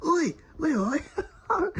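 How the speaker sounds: high, loud mock holler; calling out. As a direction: imitate pals shouting for a missing mate. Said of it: "I can see them going".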